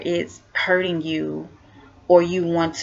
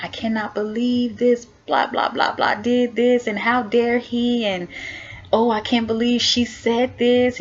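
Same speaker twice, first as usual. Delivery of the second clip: higher, imitating an upset rant at the mirror; mock venting rant; 5 semitones higher than usual. Venting to herself in the mirror, mock indignant rant. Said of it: "then I start to vent".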